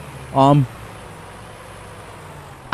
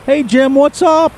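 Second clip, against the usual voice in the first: higher, slow friendly hello; bright higher slow hey Jim; cheery greeting; 12 semitones higher, 4 dB louder, slower than usual. Retold or imitated. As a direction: call out to an old friend, slowly. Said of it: "somebody will say".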